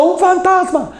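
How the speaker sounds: grito agudo de pánico; grítalo como discípulo aterrado; fantasma gritado. Retold or imitated. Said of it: "Y ellos estaban gritando". (grito agudo y asustado)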